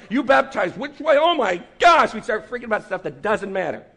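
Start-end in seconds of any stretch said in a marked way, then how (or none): 0.1-2.1 loud, higher mock shock at a fellow believer; mock outrage. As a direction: gasp in fake horror, a bit louder. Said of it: "And then we start".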